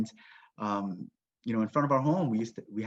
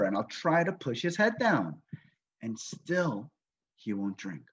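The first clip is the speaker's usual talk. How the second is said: normal talking voice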